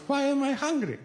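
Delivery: high, puzzled mother voice with a giggle; stoned mother. Retold or imitated. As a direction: ask it like a confused stoned old lady.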